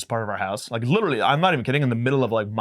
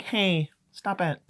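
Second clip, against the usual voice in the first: mock shushing parent; 6 semitones higher, 4 dB quieter, much slower than usual. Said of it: "We're like".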